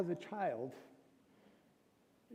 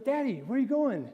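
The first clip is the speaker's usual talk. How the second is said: put-on high, eager child asking daddy; little girl voice; 7 semitones higher, 3 dB louder than usual. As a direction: imitate a small daughter calling out. Father voicing little daughter.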